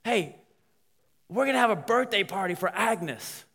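high, loud call to his wife; retold yell. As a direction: call out to someone in the back. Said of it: "he yells to his wife".

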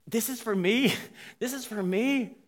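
high-pitched, astonished delight; imitating a stunned birthday girl; acted amazement. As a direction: marvel at an unexpected gift. Birthday guest's eyes light up.

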